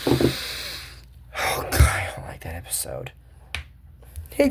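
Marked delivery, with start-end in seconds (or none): 0.1-3.1 real whispered aside; a hushed confession of dislike. Real, not acted. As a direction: breathe it out as an unvoiced aside. Aside about disliked episode.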